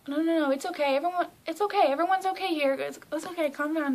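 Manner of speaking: high, loud imitated fussing to calm someone; urgent reassurance. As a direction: mimic hurried, excited comforting. Acting out star soothing crying fan.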